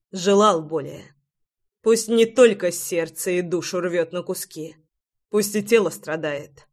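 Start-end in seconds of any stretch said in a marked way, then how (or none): none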